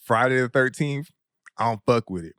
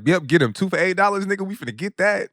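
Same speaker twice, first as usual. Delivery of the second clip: mock excited; 8 semitones higher, faster than usual. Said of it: "I was like".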